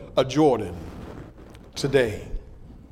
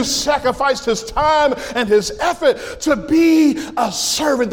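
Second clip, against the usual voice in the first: raised, ringing preacher's cadence with stretched key words; impassioned preaching; 7 semitones higher than usual. Real, not acted. Honouring the founding pastor before the congregation, impassioned preaching. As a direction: preach it out to the whole church.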